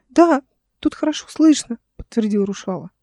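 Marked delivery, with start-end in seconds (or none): 0.1-1.8 put-on high, piping child's reply; high-pitched boyish answer; squeaky boy. A narrator's reading. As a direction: confirm in a small boy's voice. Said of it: "писклявый голос мальчика".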